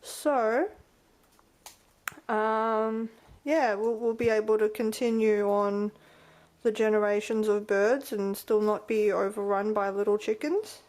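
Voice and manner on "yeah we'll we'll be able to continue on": ordinary delivery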